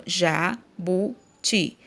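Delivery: Jabuti slowly split as JA, BU, TI; syllable by syllable. Shown as a demonstration. Teacher separating the word's little pieces, Jabuti syllable by syllable. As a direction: pause clearly between the syllables of Jabuti. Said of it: "separei aqui".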